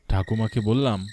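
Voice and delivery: ordinary talk, nothing marked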